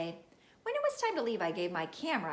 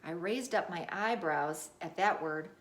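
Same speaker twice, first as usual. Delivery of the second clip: ordinary delivery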